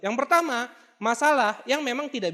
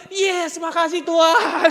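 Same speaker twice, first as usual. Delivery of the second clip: loud, high, drawn-out thanks breaking into laughter; exultant outcry; 7 semitones higher, 8 dB louder, much slower than usual. Retold or imitated. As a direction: cry out in comic triumph, stretching it. Retold relieved outcry, loud, laughing.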